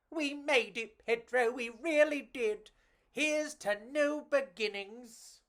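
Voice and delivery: lightly voiced cockroach. Enrico cheering arrival in a new land, lightly voiced.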